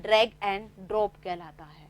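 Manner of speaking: "drag and drop" said slowly and deliberately, each word separate; slow emphasis. Shown as a demonstration. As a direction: stretch out "drag and drop" like dictating to a class. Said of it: "तो ये क्या कहलाता है". ("drag and drop" धीरे और साफ़-साफ़ बोलकर)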